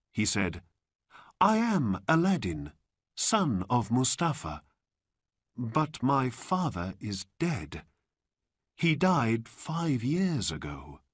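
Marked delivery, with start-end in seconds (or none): 1.4-11.0 high-voiced boy; Aladdin. A narrator's reading. Boy tells stranger about his father, high voice. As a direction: explain simply in a raised, boyish voice.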